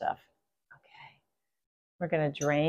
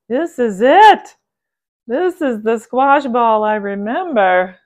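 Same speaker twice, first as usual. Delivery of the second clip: louder, slow and higher, thrilled; slow, loud cry of recognition; excited recognition; 5 semitones higher, 9 dB louder, slower than usual. Real, not acted. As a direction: savour the discovery out loud. First bite recognition.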